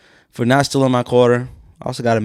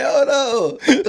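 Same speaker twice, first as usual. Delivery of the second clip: very high laughing lament; hammed-up cry of dismay; mock mourner's wail; 14 semitones higher than usual. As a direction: wail it while cracking up.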